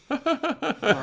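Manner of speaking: high, loud imitated kid laugh; child's copied laugh. Boy copying his parents, high laugh. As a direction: echo the laugh like a toddler copying. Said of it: "then he goes".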